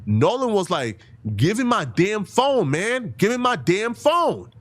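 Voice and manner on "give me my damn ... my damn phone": drawn-out, high-pitched imitation of a yelled demand; retold scream. Retelling Nolan's fight over his phone, mild mock scream. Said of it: "Nolan was actually screaming".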